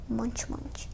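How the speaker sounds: whispered munch munch; munching sounds. Acted. Mother bear chewing berries mid-sentence, whispered munching. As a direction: chew the words softly.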